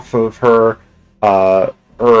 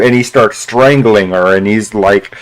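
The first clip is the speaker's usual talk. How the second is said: ordinary talk, nothing marked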